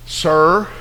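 suddenly deferential, restrained address; changed tone. Acted. Keeper to Nehemiah after reading the letter, changed tone. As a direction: woodsman checked by a royal letter. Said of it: "kinda had a different tone".

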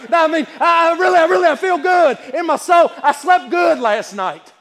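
loud, high, driving sermon cadence; full-force preaching. Acted. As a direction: preach it with fire.

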